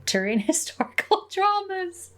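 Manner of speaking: high, laughing through the words; reading out while cracking up; amused disbelief. Real, not acted. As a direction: break into a high giggle mid-phrase. Amused by email's odd genre.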